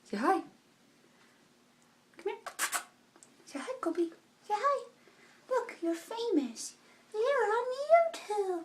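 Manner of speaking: high, soft coaxing voice for the dog; sweet high pet talk to Kobe. Real, not acted. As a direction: coo at the puppy to say hi. Talking to her dog.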